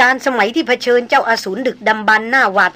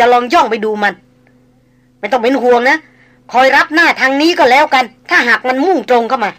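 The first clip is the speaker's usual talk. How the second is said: quick, commanding, louder and higher; firm, brave orders; 4 semitones higher, 5 dB louder than usual. Acted. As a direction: bark out orders against the clock. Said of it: "กล่าวมาโดยเร็ว อย่างมั่นคงห้าวหาญ". (สั่งการเร็ว มั่นคงห้าวหาญ)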